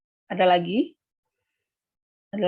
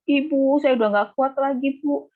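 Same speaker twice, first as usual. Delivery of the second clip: mock crying student; 7 semitones higher than usual. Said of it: "nangis-nangis".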